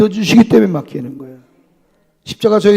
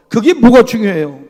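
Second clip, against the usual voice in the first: emphatic question; 4 semitones higher, 8 dB louder than usual. Shown as a demonstration. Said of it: "repeated, louder take".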